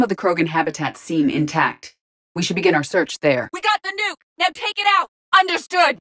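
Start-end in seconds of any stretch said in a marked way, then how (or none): 3.5-6.0 very high-pitched battle yell; urgent combat shout. Acted. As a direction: yell over the fight.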